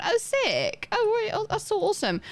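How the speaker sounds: high-pitched delighted reaction; thrilled for someone; real excitement. Real, not acted. Delighted at a viewer's theatre plans.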